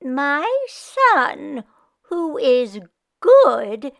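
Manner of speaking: hesitant proud mother; Meshka voice. Acted. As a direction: force out the kind word. Meshka tries praising.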